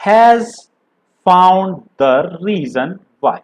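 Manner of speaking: slow, deliberate poem reading with drawn-out words; poem recitation. Acted. Teacher reading a poem to students, slow recitation. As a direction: recite line by line with long pauses.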